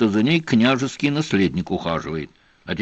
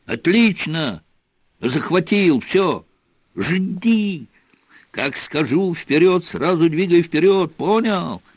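high yelled instructions; shouted orders; 6 semitones higher than usual. A narrator's reading. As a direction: bark orders across the boat. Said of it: "перекрикивались". (громкие команды)

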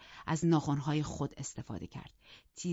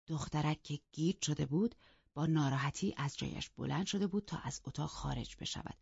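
ordinary delivery

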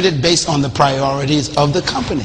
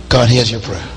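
ordinary talk, nothing marked

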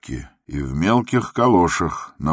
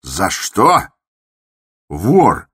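louder, higher disgusted shout; 5 semitones higher, 8 dB louder, much slower than usual. A narrator's reading. The patient accuses the doctor, shouting with disgust. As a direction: spit the word out in revulsion. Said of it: "проговорил он с отвращением".

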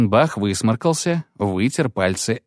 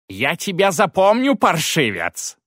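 higher, slowed threatening yell; priest yells threat; 9 semitones higher than usual. Acted. Priest threatens the dwarf, yelling. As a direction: promise revenge. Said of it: "крикнул он".

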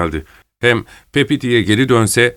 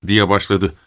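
plain reading voice

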